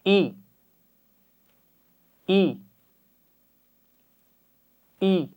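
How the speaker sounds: short glottal "ای", not drawn out; glottal i sound. Shown as a demonstration. Teacher modelling the hamza with zer, a short glottal "ای". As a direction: say "ای" crisp from the throat, not long. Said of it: "سب مل کر پڑھیے".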